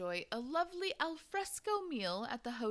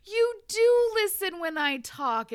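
loud, high, slow delighted cry; delighted exclamation; 10 semitones higher, 11 dB louder than usual. Acted. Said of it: "exclaimed Magnus".

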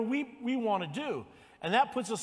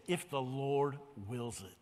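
slow and soft; 7 semitones lower, 7 dB quieter, slower than usual. Shown as a demonstration. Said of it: "That's number one today".

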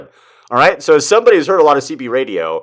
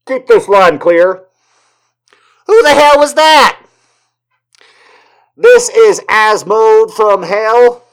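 higher, louder radio voice; booming CB voice; 8 semitones higher, 5 dB louder, slower than usual. Retold or imitated. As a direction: bark it over the CB like a trucker. Other CB voices.

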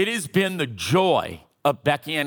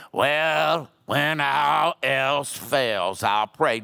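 slow, offhand mock excuse; mock lazy excuse; 3 semitones lower, much slower than usual. Preacher mimicking people who pray last, slow offhand mock. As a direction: sound like you only pray as a last resort. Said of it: "when people say".

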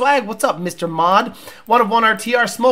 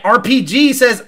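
normal talking voice

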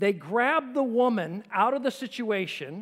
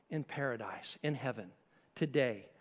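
hushed awe; 8 semitones lower, 7 dB quieter than usual. Real, not acted. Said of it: "preacher softens on heaven".

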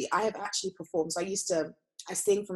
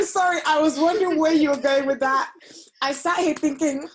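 higher, laughing apology; 8 semitones higher, 7 dB louder than usual. Real, not acted. Teasing her cohost about the ramble, laughing. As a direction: speak through a fit of giggles.

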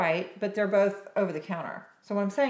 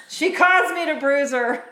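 mock blame; 7 semitones higher, 10 dB louder than usual. Real, not acted. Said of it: "I'm gonna blame it on the patient".

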